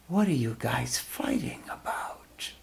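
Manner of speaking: soft whispered question, calm and sweet. A narrator's reading. Mohini sweetly asking the quarrelling gods and demons, whispered. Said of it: "very calmly, sweetly said".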